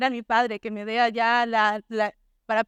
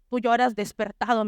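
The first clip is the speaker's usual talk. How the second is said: normal talking voice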